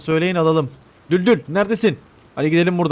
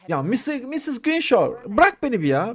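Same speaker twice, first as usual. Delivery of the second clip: whining plea; 9 semitones higher than usual. Real, not acted. Whining at the game character.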